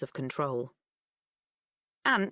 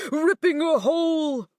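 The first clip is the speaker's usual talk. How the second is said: dramatic build; 8 semitones higher, 6 dB louder than usual. Acted. Ghost story climax.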